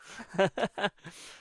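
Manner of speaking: quick run of giggles; laugh. Real, not acted. Reader amused by her own dollar-store joke, laughing.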